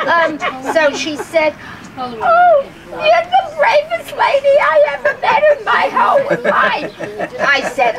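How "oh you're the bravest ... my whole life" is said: retold admiring neighbor, high and breathless with laughter; gushing neighbor. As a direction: gush the praise up high, half laughing. Neighbor quoted gushing praise.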